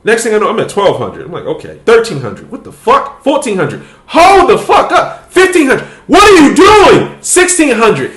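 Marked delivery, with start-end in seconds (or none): 2.5-8.1 higher and louder with each count, laughing; rising excitement. Retold or imitated. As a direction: build the disbelief as the numbers climb. Counting likes climbing fast.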